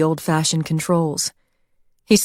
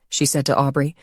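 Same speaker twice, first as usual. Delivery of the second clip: plain reading voice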